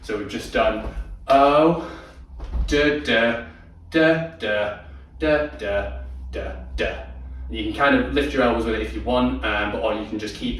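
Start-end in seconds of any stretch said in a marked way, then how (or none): 1.3-7.0 slowly sung da da beats, half speed; slow demo count. Shown as a demonstration. Teacher slowing the shrug beat down for the class. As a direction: scat the beat slowly so they can follow. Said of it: "I'll do it again slowly".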